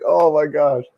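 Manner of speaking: high, loud retold disbelief; shocked exclamation. IT guy reliving the cheating-wife call, stunned. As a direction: gasp it out like the call just floored you. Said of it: "I'm like".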